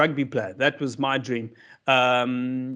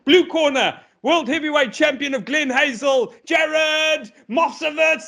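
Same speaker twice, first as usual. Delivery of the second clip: boxing ring announcer; 11 semitones higher than usual. Retold or imitated. Comedian imitates the ring announcer introducing the boxer. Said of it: "when they announced him".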